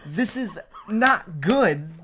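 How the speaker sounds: slow, loud, every word leaned on; emphatic verdict. Real, not acted. Host hammering home his verdict on the album, slow and loud. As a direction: stamp it down as the final word. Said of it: "I can't put enough emphasis on that".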